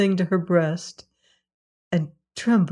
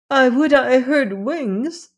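put-on higher, slow, yearning old woman; longing cry; 7 semitones higher, 3 dB louder than usual. A narrator's reading. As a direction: reach up for the hope, slowly. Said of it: "she cried".

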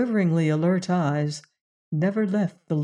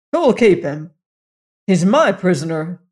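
louder hot-tempered outcry; hot cry; 6 dB louder than usual. Acted. Tull crying at the rider hotly. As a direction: fire it back angrily. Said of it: "cried Tull hotly".

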